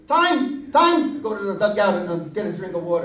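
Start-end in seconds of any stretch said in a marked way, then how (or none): 0.1-1.2 put-on high, eager little-boy call; a boy calling timeout to his coach, high and eager; kid calling time. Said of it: "I'd be".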